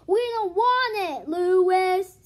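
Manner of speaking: loud protest. Acted. Eric Bacon to Louis, loud protest. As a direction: shout them down. Class rejecting the computer.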